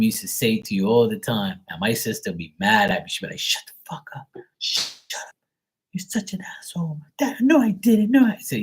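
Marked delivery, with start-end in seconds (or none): 3.4-7.0 mock whispered hissed insults, higher. Sister hissing insults at brother. 7.2-8.3 whiny denial. Retold or imitated. Sister denying to dad.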